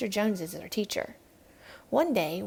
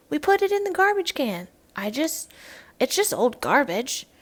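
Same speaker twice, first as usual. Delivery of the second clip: put-on child's answer; 5 semitones higher, 4 dB louder than usual. Child answers teacher in class.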